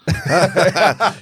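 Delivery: bursts out laughing; big amused laugh. Real, not acted. Laughing at the impression.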